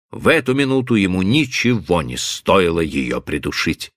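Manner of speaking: ordinary delivery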